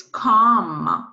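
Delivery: "come" begun with a hard K; hard French C. Shown as a demonstration. Teacher showing C before other vowels, "come" with hard K. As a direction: say "come" as French comme, K sound first. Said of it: "because you don't have any".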